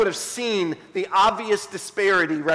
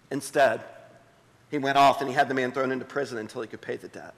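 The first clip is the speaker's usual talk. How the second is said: hushed reading of scripture; 6 semitones lower than usual. Acted. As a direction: read the parable gently to the congregation. Pastor reads the parable aloud.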